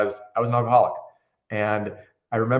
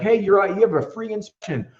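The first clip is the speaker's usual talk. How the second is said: salesman pitch; 9 semitones higher, 4 dB louder than usual. Retold or imitated. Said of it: "And I'm like".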